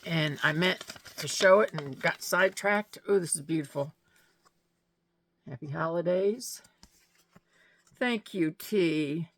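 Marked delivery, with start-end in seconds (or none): none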